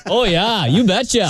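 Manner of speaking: a light Minnesota "You betcha"; Minnesota accent. Retold or imitated. Answering the accent question, light Minnesota accent. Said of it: "How about those accents".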